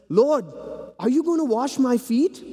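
high, startled question; a disciple caught off guard; startled Peter. Acted. Peter startled by foot washing.

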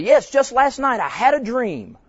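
high, loud and slow, bursting to tell; eager woman's voice. Retold or imitated. Pastor imitating the woman eagerly announcing her dream, high and loud. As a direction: mimic her keen, drawn-out answer.

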